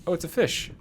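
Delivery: high surprised burst; suddenly notices, pitch jumps up. Real, not acted.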